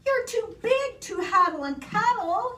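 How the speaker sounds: high storybook character voice; high, dismayed little complaint; Eddie's dismay. A narrator's reading. Eddie to the giant teddy, dismayed.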